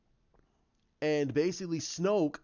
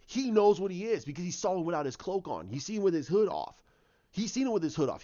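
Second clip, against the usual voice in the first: usual speaking voice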